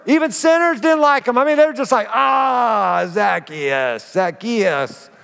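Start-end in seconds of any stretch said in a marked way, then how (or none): 2.1-5.1 mock disgust. Imitating crowd's disgust at Zacchaeus.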